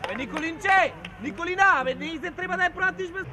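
loud call across the field; real coach's shout; shouted reprimand. Real, not acted. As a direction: yell the player's name, then the order. Coach calling a player.

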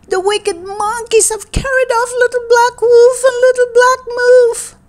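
high, loudening wail of alarm; crying out. A narrator's reading. Mother tells her son the babies are stolen, wailing. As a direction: wail the dreadful news at a high pitch. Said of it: "cried she".

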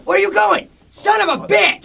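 high, loud yell after someone leaving; yelling after a fleeing bandmate; angry yelling. Acted. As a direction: shout at him as he runs off.

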